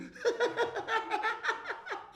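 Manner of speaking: put-on high-pitched child's giggling fit; joining in laughing. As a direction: catch the laughter and let it peal out. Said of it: "I start laughing too".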